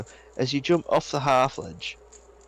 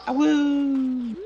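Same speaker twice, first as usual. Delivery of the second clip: imitated wolf howl; 14 semitones higher than usual. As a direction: mimic a wolf howl without much force. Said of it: "the wolf noise is like".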